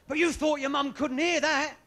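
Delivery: usual speaking voice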